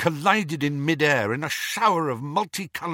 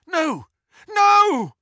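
anguished denial; 14 semitones higher, 7 dB louder than usual. Acted. Owl struck by curse.

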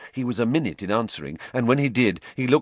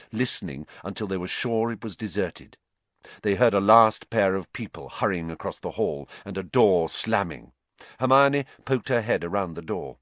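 ordinary delivery, nothing marked

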